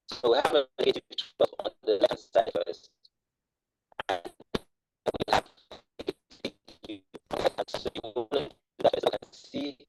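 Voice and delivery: choppy connection, words dropping out; broken-up audio on a bad call; audio cutting out. Real, not acted. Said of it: "your audio keeps cutting out".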